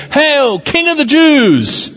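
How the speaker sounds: high, stretched-out acclamation; soldiers' hail. A narrator's reading. Soldiers saluting the thorn-crowned prisoner, high drawn-out hail. Soldiers hailing Jesus in scripture reading.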